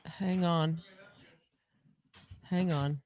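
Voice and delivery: normal talking voice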